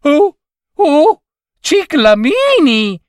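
high, drawn-out delighted squeal; joyful exclamation. A narrator's reading. Lady spots cyclamens in the wood, overjoyed cry. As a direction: exclaim with gleeful wonder. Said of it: "tutta giuliva".

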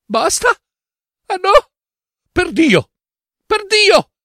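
high, trembling indignant snap; indignant outburst. Acted. Doctor Fileno snapping at the author's dismissal, high indignant outburst. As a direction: flare up in outrage. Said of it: "scattò il dottor Fileno con un fremito di indignazione".

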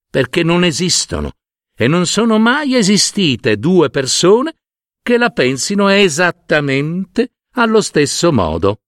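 plain reading voice

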